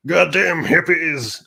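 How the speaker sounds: put-on slow, high, loud old-man outburst; mock outraged boomer shout; grumpy boomer yell. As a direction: bark it like an angry old boomer. Boomers yelling at hippies.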